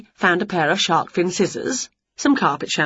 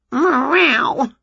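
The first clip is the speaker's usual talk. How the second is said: cat meow; 7 semitones higher than usual. A narrator's reading. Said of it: "went Wilbur".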